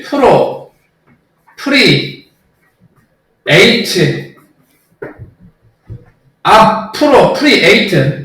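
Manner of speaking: 프로, 프리, 에이트 said as separate chunks with pauses; syllable-by-syllable drill; chunked syllables. Shown as a demonstration. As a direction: break 프로 프리 에이트 into sound chunks. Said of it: "끊어서 소리만 들어서 외우시라고요".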